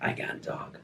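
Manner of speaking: hoarse, voiceless whisper of a man who lost his voice. Retold or imitated. Friend with lost voice whispers he can't talk. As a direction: breathe it out with no voice at all. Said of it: "he's like, lost his voice".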